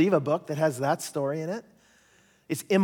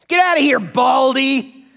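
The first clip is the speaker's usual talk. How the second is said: high, loud schoolyard taunt; jeering boys; 9 semitones higher, 9 dB louder than usual. Retold or imitated. Boys jeering at the bald prophet, loud taunt. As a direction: jeer it like mocking kids. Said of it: "they said".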